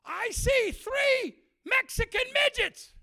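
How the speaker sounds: very high, slow, loud shout; yell. Retold or imitated. Preacher yells out his vision, slow high shout. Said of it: "And I yell".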